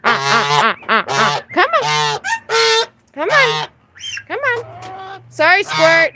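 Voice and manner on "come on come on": sing-song coaxing to a bird; high coaxing pet voice. Real, not acted. Owner coaxing her parrot to come see babies, high sing-song. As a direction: coo high and bright to coax the bird out.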